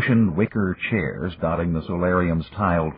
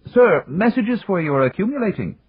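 high, prim servant voice; a dutiful mechanical butler; polite butler robot; 14 semitones higher, 3 dB louder than usual. Acted. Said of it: "The butler robot trundled up".

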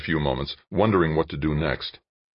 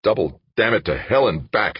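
angry, loud and higher outburst; exploding; 6 semitones higher, 5 dB louder than usual. A narrator's reading. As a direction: curse in exasperation, voice raised. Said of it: "Dan exploded".

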